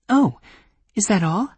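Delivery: put-on young woman voice. Voicing Granuaile.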